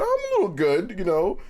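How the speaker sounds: very high, mock-bashful boy voice; bashful young self. His fifteen-year-old self answering a compliment, high mock-bashful. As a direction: pitch way up, hammed-up coy acceptance.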